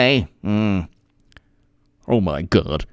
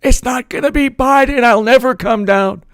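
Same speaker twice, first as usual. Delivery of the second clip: defiant holdout; 11 semitones higher than usual. Retold or imitated.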